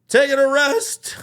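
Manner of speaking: high, loud, drawn-out holler; calling back. Retold or imitated. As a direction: holler back across a distance. Drunk shouts back to police.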